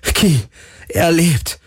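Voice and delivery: high, elated cry; jubilant. A narrator's reading. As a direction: cheer the good news out. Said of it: "jubelte Galen".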